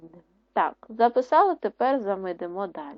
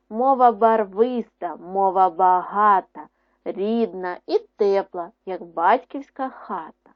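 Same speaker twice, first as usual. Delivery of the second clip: louder, expressive poem reading; poem recital; 5 dB louder than usual. Acted. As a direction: recite the verse to the children with feeling. Teacher reads second poem. (виразне читання вірша)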